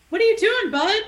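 high, loud startled call; 1 exclaimed. A narrator's reading. Wynn calling out to Ron across the table, loud and high. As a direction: raise the voice to stop a friend. Ron's toast interrupted at dinner.

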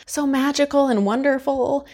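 high, dreamy tone of wonder; mock wonder. Mimicking the film's wondrous opening.

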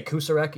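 Kucerek said as KOO-suh-rek, s in the middle; own mispronunciation. Shown as a demonstration. Host shows co-host how he says the coach's name, Kucerek as KOO-suh-rek. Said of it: "pronouncing it wrong".

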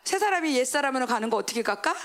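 usual speaking voice

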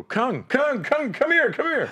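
high, loud excited call across the house. Retold or imitated. Calling his housemate over to meet Tyson, high excited shout. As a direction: yell for your housemate to come see.

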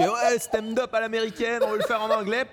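high, sing-out mock enthusiasm; keen organisers. Club wants an English American-style night, high mock eagerness. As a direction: parody eager promoters pitching a trendy idea.

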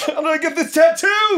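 high, loud wowed shout; hammed-up astonished fan; mock amazement. Joins mock amazed magic fan.

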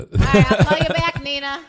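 hurried phone goodbye; dodging a caller. Real, not acted. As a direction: cut the caller off politely. Ending the call.

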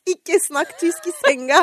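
emphatic warning. Real, not acted. Giving one tip on this podcast, emphatic warning. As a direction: proclaim it as vital advice, word by word. Mock advice to podcast listeners.

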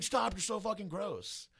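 high, drawn-out imitated whine of disgust; disgusted protest. Friend protesting the naked teasing, disgusted whine.

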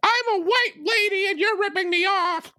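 very high-pitched mock shriek; mock screaming. Co-host parodying the shopper who screams at a vendor, mock screaming. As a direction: screech it as a mocking imitation. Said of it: "Instead of just screaming".

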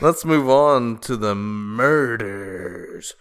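loud, slow mock-ominous announcement; dramatic announcer. Host opening the murders segment, dramatic announcer. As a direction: boom it out, stretching the last word.

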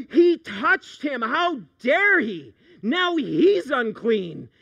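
hammed-up outrage of the religious onlookers; scandalized. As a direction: clutch your pearls on every word.

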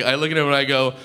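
ordinary delivery

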